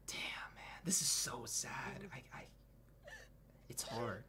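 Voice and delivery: very soft, mostly whispered, halting; real hushed heartache; saddened hush. Real, not acted. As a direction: murmur it low, choked up by sadness. Moved by sad scene.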